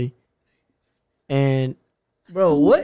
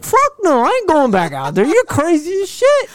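high, louder defiant squeal; hammed-up high-pitched refusal; retold refusal; 14 semitones higher, 4 dB louder than usual. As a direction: squeal it back at the stepdad. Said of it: "I was like".

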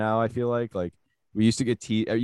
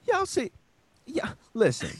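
southern impression; 10 semitones higher, 3 dB louder than usual.

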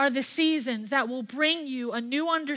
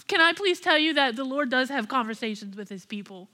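ordinary talk, nothing marked

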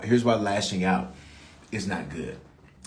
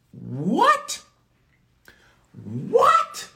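mock astonishment; 13 semitones higher than usual. Astonished reaction to God's verdict.

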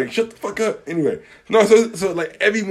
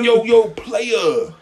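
higher, drawn-out mock-cool greeting; overdone slang greeting; 5 semitones higher, much slower than usual. Coworker greeting only me, slow exaggerated slang. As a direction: stretch out the last word, imitating someone overdoing it. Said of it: "With, with extra".

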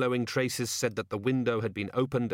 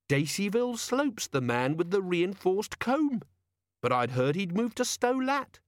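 excited; 9 semitones higher than usual. A narrator's reading. Carrot excitedly recalling the suspect.

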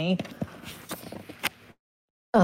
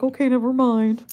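voicing the timid dog; 5 semitones higher than usual.

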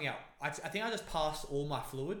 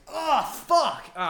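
much higher, loud pained cry; getting a leg cramp; 12 semitones higher, 13 dB louder, slower than usual. Real, not acted. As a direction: yelp as the cramp returns. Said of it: "I gotta keep my legs straight".

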